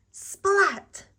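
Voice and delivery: high, loud burst on one word; exclaimed splat. A narrator's reading. As leaves go flying everywhere, exclaimed splat. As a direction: let it burst out like a crash.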